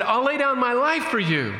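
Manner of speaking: Peter. Retold or imitated. Peter pledges himself to Jesus.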